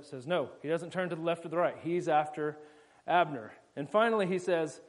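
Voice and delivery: usual speaking voice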